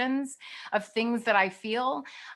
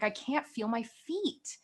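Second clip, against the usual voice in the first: softer, slower private realization; quiet inner voice; 7 dB quieter, slower than usual. Retold or imitated. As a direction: say it softly, as if to yourself. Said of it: "when I would get quiet in meditation".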